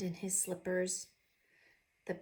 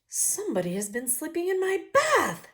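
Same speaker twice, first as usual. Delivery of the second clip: much higher, much louder daddy voice; 8 semitones higher, 7 dB louder than usual. Acted. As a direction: boom out the complaint as the daddy. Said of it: "said the daddy person".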